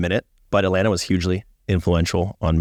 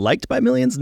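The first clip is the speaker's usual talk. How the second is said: mock high-pitched question. Teasing correction in high voice.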